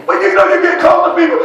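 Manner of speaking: full-voiced preacher's retort; preaching shout. Real, not acted. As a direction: hurl it back at the devil. Preacher shouting back at devil.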